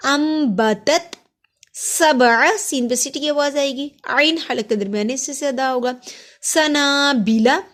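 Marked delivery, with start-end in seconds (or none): 0.1-1.2 slow, drawn-out tajweed recitation; Quran recitation demo. Shown as a demonstration. Teacher reciting slowly for students. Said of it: "अब देखिए ना को लंबा किया". 6.4-7.6 सनाबिला with the ना held long; lengthened vowel. Shown as a demonstration. Teacher demonstrating a long vowel to students. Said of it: "सना, अब देखिए ना को लंबा किया".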